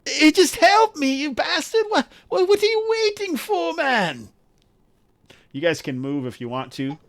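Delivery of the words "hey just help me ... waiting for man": very high, impatient wailing voice; Urks, exasperated. Acted. Game master as Urks demanding help, exasperated wail. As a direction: plead crossly in a high strained character voice. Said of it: "but Urks is like".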